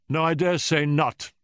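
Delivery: put-on testy old professor's rebuff; asperity. A narrator's reading. Professor retorts to Holmes's refusal, with asperity. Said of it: "said the Professor with asperity".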